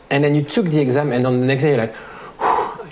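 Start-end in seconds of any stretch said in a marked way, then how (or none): 2.4-2.9 unvoiced puff of mock relief; relieved exhale. Coach acting out the day after an exam, relieved exhale. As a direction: let out a whew as pressure lifts. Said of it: "you don't have this pressure anymore".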